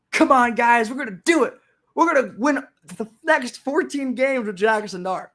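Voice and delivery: high-pitched mock rallying cry; rally the fans with fake optimism; over-eager, tongue-in-cheek pep talk; sarcastic hype. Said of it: "he can't tell that I'm being sarcastic".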